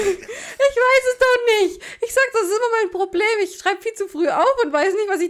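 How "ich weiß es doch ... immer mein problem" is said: whiny. Real, not acted. Defending her odd notes.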